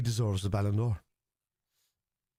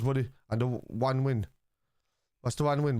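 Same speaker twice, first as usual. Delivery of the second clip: ordinary talk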